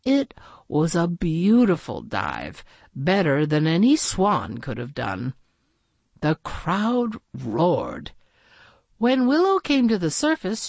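ordinary delivery

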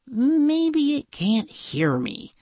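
slow, high, puzzled musing; drawn-out, high private thought; princess thinking. A narrator's reading. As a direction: think aloud as the heroine. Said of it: "she thought".

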